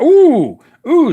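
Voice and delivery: loud, high startled ooh; surprised ooh. Real, not acted. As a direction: react to the bad roll. Dice roll goes badly.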